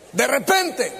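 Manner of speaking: usual speaking voice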